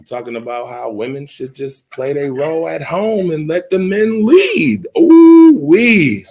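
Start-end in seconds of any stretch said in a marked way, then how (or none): 3.7-6.2 high, loud scandalized ooh-wee; loud exclaim. Real, not acted. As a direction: exclaim as if shocked. Reacting to scandal.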